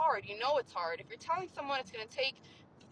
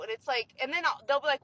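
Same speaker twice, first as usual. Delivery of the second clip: ordinary talk, nothing marked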